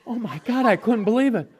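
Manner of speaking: ordinary talk, nothing marked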